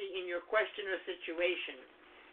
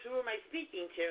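usual speaking voice

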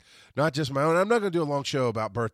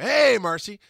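louder, higher shout of hello; calling out; 8 semitones higher, 8 dB louder, slower than usual. Real, not acted. Podcaster greets Marcy mid-show, calling out. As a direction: call out a quick hey to someone.

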